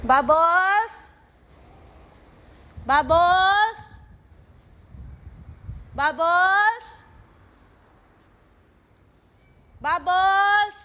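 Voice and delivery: loud rising call across a distance; calling the cat. Real, not acted. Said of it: "tatawagin ko si Bubbles".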